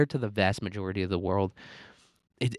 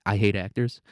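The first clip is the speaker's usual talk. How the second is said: usual speaking voice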